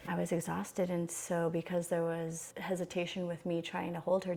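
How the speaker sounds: normal talking voice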